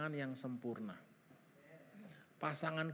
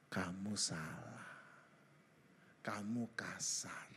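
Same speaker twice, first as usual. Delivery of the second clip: slow, low whisper; hushed, drawn-out voice from within; inner voice; 10 semitones lower, 7 dB quieter, much slower than usual. Acted. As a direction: whisper like a conscience accusing you. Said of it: "ada suara di hati".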